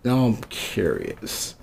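drawn-out, quiet, faux-innocent reply; mock innocent. Defensive answer to mom.

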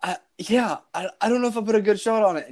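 high, rushed mimicry of rattled wife; imitating shaking voice. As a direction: stammer it high and quick, as if shaking. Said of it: "hear her voice, like, shaking".

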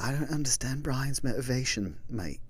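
put-on a light British accent as a puzzled actor; a British actor musing over Brian's motivation, lightly voiced. Podcaster imitating a future actor playing him, mock British accent. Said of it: "I don't know why I went British".

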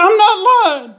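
high, loud protesting yell; shouted denial. A narrator's reading. Husband denies lying to his wife, shouting.

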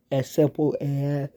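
talking through a yawn; words stretched by a yawn. Real, not acted. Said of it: "I'm yawning".

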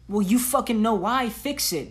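ordinary delivery

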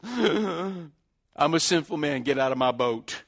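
slow, drawn-out confession of unworthiness; overwhelmed penitent. Retold or imitated. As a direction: act out Peter humbled, dragging the words. Peter bows down, overwhelmed.

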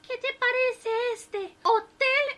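high, slow eager wife; the wife announces the hotel name loudly; wife's voice. A narrator's reading. Wife proposes another hotel.

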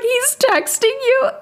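surprised. Retold or imitated. Friend shocked he texts.

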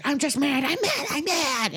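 put-on high, insistent mad-kid voice; angry child. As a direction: imitate a fuming child, pitch climbing. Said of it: "why you're so angry".

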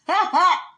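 loud, high, short laugh; real overwhelmed cackle; high burst of laughter. Real, not acted. Overcome with laughter.

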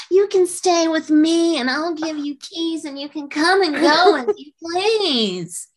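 put-on high, gushing drunk invitation, drawn out at the end; drunken gushing offer. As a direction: gush it in a high tipsy voice, stretching the last words. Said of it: "I went up and I wasted".

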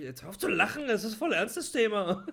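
loud, high, breaking into laughter; laughing while scolding. Real, not acted. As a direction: try to stay serious but laugh. Scolds partner while both laugh.

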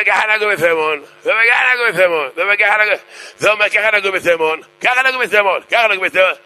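high-pitched mock quarrel; acted squabbling. Gabbais quarrel before the rabbi.

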